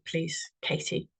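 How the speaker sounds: ordinary talk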